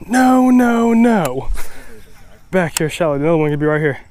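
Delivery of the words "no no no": long, high, loud wail of disappointment; dismayed cry. Real, not acted. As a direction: cry out in dismay at losing the fish. Angler loses a fish.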